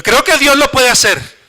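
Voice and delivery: preacher raising the voice to drive the point home; emphatic declaration. Shown as a demonstration. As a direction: lift "creo que Dios lo puede hacer" up and proclaim it. Sermon builds emphasis.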